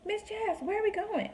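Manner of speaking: high-pitched mimicked child's voice; nanny kids. Nanny kids asking where they're headed, high mimicked whine. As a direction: imitate kids piping up from the back. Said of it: "they're always like".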